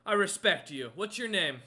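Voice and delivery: loud booming giant voice, earnest; respectful giant. Acted. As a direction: declare respect in a big loud voice. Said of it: "into your bucket and goes".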